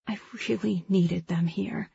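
soft, lightly muffled mumble; understated, quiet admission; mumbled. A narrator's reading. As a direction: murmur it softly into someone's shirt. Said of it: "I mumble into his shirt".

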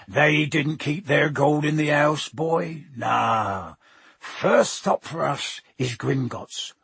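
hearty giant, slowly explaining; Hagrid, cheerfully dismissive. Acted. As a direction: brush the worry aside in a big rustic voice.

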